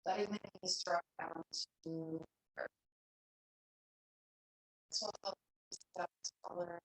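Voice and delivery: soft, unhurried meditation voice with long pauses; guided meditation. Real, not acted. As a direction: guide them gently into stillness, leaving long silences. Leads a seated meditation.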